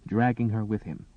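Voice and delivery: plain reading voice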